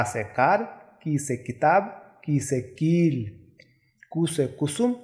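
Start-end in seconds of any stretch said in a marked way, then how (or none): 2.3-3.3 कील with a stretched ii; long ii. Shown as a demonstration. Teacher shows long ii in कील. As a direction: hold the vowel in कील slowly. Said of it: "बड़ी ई की मात्रा".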